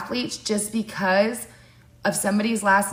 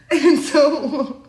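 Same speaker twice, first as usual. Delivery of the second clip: laughing; 8 semitones higher, 4 dB louder than usual. Real, not acted. Laughing high.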